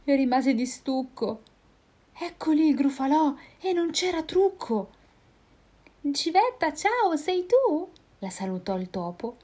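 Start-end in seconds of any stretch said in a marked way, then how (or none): 2.2-4.9 high owl voice; startled owl. Acted. Owl seeing the Gruffalo, high and startled. 6.1-7.9 high, slow sing-song hello; cheeky mouse greeting. Acted. Mouse greeting the owl, high sing-song.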